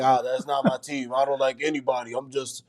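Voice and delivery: a hint of a sulky coach muttering along; imitating a moody head coach; grumpy coach impression. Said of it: "Was that Tom Thibodeau or me?".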